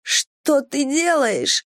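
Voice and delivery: high, drawn-out protest; crying out in protest. A narrator's reading. A woman to the lover who stopped, crying out. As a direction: wail the question in frustration. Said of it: "кричу, желая скорее избавиться".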